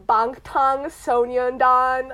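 put-on slow, careful Korean-accented name. Mocking K-pop talkers switching for names, Korean accent. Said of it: "try to switch to a Korean accent".

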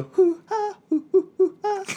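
high-pitched hooting; mimicked mocking noises. Son answering angry dad with bad lip reading, silly hooting. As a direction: hoot it high in silly imitation. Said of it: "that bad lip reading".

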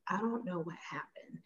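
soft, breathless whisper; whispered shock; stunned whisper. Retold or imitated. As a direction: barely get the words out, frozen. Frozen after the outburst.

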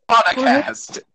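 podcast said as "pod-a-cast", drawn out; extra A in podcast. Shown as a demonstration. Friend reading out the misspelling, pod-a-cast for podcast. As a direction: stretch podcast into three beats, pod-a-cast. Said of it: "There's an A behind the D".

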